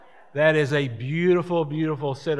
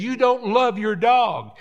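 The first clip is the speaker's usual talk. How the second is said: city person accusing; 7 semitones higher, 5 dB louder than usual. Retold or imitated. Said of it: "And the city people is".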